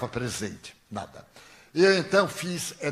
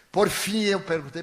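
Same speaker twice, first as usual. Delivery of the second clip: usual speaking voice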